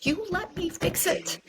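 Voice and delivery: high, strained voice close to breaking; choked-up refusal. Retold or imitated. Wife refusing to accept dying husband's words, choked-up pleading. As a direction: insist through a tightening throat, pitch climbing.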